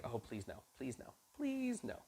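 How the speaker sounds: soft desperate pleading, hushed. Retold or imitated. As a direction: beg quietly for it not to be so. Job dreading another servant.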